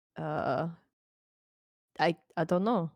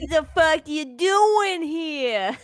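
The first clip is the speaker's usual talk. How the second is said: slow, loud, high Boston tough guy; drawn-out Boston accent, loud; 11 semitones higher, 8 dB louder, much slower than usual. Pretend. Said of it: "So, I can do a Boston accent".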